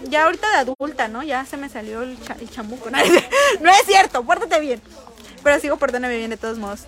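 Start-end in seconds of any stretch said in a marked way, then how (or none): 3.0-3.5 high, loud giggle; sudden bright laugh; burst of laughter. Real, not acted. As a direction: laugh at your own joke. Jokes about inner devil.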